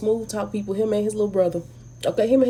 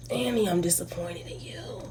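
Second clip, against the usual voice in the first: whispered, soft and let down; disappointed whisper; 3 semitones lower, 9 dB quieter than usual. Acted. Talking to Annie on screen, a disappointed whisper. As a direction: breathe it out like a scolding secret.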